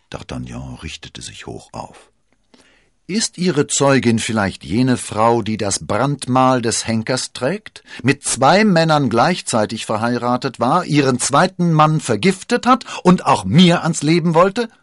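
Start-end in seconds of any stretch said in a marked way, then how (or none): 3.1-14.7 high, loud, deliberate accusing question; indignant challenge. Acted. D'Artagnan exposes the cardinal's witness, indignant and building. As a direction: build louder and higher to the last clause.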